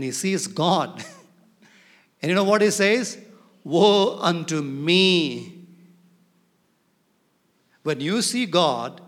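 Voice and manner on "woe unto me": drawn-out, louder proclaimed cry; quoted lament. Acted. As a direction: stretch it out slowly and forcefully. Prophet's cry on seeing God.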